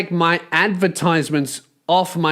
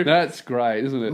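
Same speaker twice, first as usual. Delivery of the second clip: normal talking voice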